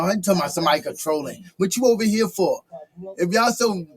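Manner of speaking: ordinary talk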